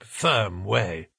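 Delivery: ordinary delivery, nothing marked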